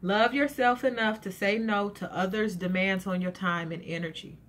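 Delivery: normal talking voice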